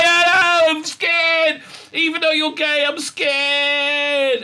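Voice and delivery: very high mock-scared wail; hammed-up terrified whine, drawn out; Saru. Scared Saru answering the doctor, wailing. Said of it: "he's very, very scared".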